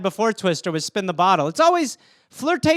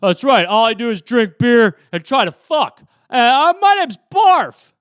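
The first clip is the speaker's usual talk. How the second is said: dumb jock Barf; 3 semitones higher, 8 dB louder than usual. Retold or imitated. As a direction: bellow it like a beer-chugging linebacker. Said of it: "I was just like".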